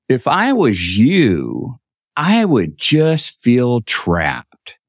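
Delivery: drawn-out, deliberate words; slow, pointed pitch. Retold or imitated. Brother selling a boat to an islander, slow and pointed. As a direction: stretch each word to let it sink in.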